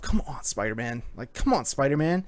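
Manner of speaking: soft, low urging under the breath; root for him quietly, as if in your own head; inner urging. Retold or imitated. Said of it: "you're thinking to yourself".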